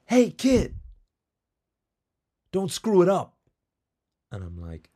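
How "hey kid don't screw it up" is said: loud, much higher gruff shout from the bleachers; tough Italian guy. Retold or imitated. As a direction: bark it down at the kid like a big Italian fan. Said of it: "He's like".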